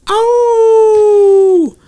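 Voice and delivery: long, very high, loud howl; wolf howl. Retold or imitated. Comic's wolf joke punchline, long high mock howl. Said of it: "the wolf went".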